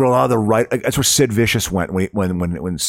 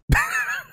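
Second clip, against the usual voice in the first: high laugh. Real, not acted. Laugh after money joke.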